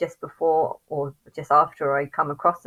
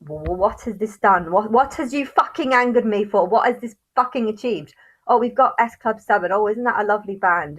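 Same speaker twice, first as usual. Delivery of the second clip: angry; 4 semitones higher, 6 dB louder than usual. Real, not acted. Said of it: "And then I think about".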